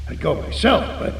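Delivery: usual speaking voice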